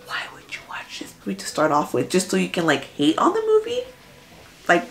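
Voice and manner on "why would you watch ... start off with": hushed, voiceless whisper; whispered question. Real, not acted. Whispered rhetorical question to haters.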